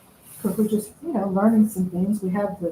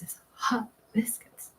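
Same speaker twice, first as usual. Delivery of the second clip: whisper; 3 semitones higher, 8 dB quieter than usual. Pretend. Whispering her nickname.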